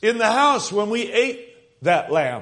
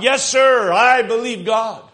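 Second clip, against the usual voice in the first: put-on louder and higher, a boy repeating his father with zeal; eager echo; 3 semitones higher, 5 dB louder than usual. Son echoing his father's faith, eager loud affirmation. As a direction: echo it back, full of conviction.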